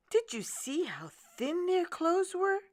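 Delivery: a concerned wife's high voice; wife asking. Acted. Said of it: "his wife asked".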